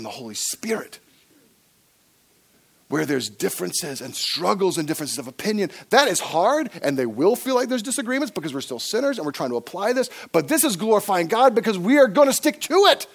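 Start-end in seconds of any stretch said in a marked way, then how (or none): 5.9-13.1 higher, raised, insistent voice; rally them to hold on; impassioned preaching. Real, not acted. Preacher urging church through disagreements, impassioned. Preacher rises to full force.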